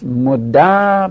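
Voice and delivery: مده as "modda", open final "a"; fatha ending. Shown as a demonstration. Teacher recapping the forms, مده with fatha, "modda". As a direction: hold the fatha at the end of مده.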